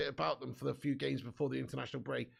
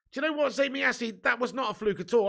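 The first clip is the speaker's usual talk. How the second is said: indignant retort; 8 semitones higher, 6 dB louder than usual. Real, not acted. Retorting to rival fan's chat.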